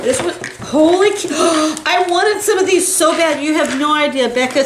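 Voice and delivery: high, gasping excitement; overexcited. Real, not acted. Getting a gift she badly wanted, overexcited.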